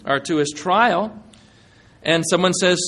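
ordinary talk, nothing marked

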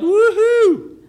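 high, loud whoop of joy; a hammed-up shout of delight; joyful yell. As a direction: whoop like a worker paid a fortune. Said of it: "jumped up and yelled".

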